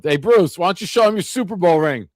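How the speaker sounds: a playful shouted jab; retold heckling yell. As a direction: call out a mocking question, moderately loud. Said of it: "and I yelled".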